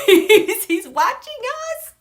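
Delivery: high, loud, laughing delight; giddy laughter. Real, not acted. Astrologer telling viewers Roosevelt is watching, giddy laughter. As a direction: burst out laughing through the words, drawn out.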